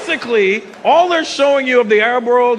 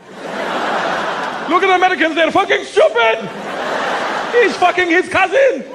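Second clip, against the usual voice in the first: much higher, laughing mock commentator; high, amused foreign TV viewer voice; 11 semitones higher than usual. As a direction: sneer at Americans with a laugh. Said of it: "all they show them of America is Jerry Springer".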